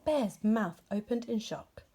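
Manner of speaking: ordinary delivery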